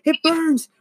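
high, loud retold scream of pain; hammed-up high panicked yell. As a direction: yell to mom like you're on fire.

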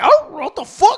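a high, loud startled yelp voiced for the dog; mock dog yelp. Telling friends how the dog reacted, high startled yelp. Dog yelps as weight lands.

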